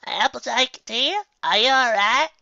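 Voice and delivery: put-on high, slow old-granny voice; Granny Smith's concern. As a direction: fuss over her like an old granny.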